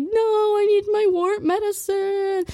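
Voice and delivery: high, slow, whining plea; mock whining over wart medicine; whiny me. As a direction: wail it like a child. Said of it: "I'm like".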